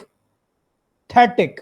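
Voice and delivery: Pathetic with barely-there प, थे stressed high; stress on थेटिक. Shown as a demonstration. Teacher repeats pathetic for students, stressing थे, प swallowed. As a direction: eat the प in pathetic, land high on थे. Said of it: "pathetic पे stress है, प खाया जा रहा है".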